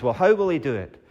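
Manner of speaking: ordinary delivery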